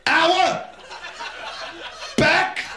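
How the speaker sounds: high squeaked hour back; squeaky punchline. Pretend.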